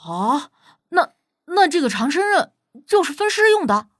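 stammered start rising to a high question; appalled realization. Acted. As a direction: gasp it out as the horror sinks in. Xiao Jia realizes with horror, recoils.

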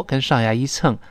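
ordinary delivery

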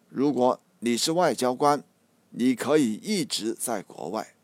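usual speaking voice

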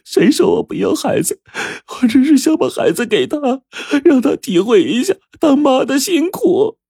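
very high, sobbing acted voice; tearful wail. A narrator's reading. Mother protests she does want her child, tearful wail. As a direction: wail it through mock tears. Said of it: "哭着说".

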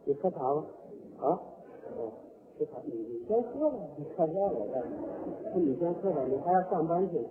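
hushed polite back-and-forth, pitched low; soft, courteous murmur. Acted. Loving couple urging tea on each other, soft low courtesy.

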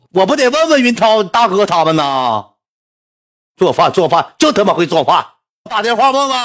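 loud, high-pitched griping; exasperated husband. Retold or imitated. Husband grumbling at wife about cooking, exasperated.

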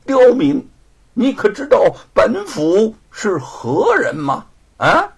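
loud, high, slow official's bluster; imperious threatening demand; haughty sneer. A narrator's reading. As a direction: lord it over him, drawn out and loud. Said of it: "冷哼着说".

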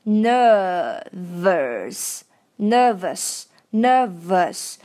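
"nervous" said slowly with a light curled r; curled r drill. Shown as a demonstration. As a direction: roll a small curled r into "nervous". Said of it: "这里有个小卷舌".

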